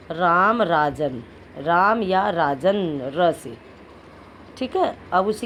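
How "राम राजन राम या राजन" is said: slow, clear teaching of example words. Shown as a demonstration. Teacher giving examples to pupils, slowly. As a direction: say each example word slowly. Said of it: "इससे क्या बन जाएगा".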